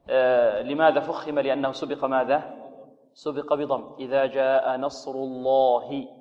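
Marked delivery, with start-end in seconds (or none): 4.0-6.1 الله with a thick, heavy lam; slow recitation, full heavy lam. Shown as a demonstration. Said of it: "تفخيم، تغليظ اللام". (تغليظ اللام في نصر الله)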